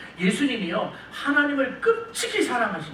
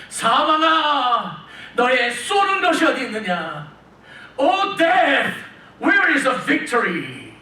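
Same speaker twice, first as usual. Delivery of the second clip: very slow, higher, louder defiant call to death; bold challenge; 4 semitones higher, 3 dB louder, much slower than usual. Retold or imitated. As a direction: challenge death boldly, drawing out each word. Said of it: "당당하게 따지는 거예요". (죽음에게 당당하게 따지는 느리고 큰 목소리)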